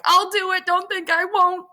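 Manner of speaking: high, loud dramatic threat; mock threat. Juliet holds the dagger to her chest, high desperate threat. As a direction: act it as a high-pitched, frantic dare.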